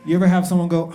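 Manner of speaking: ordinary delivery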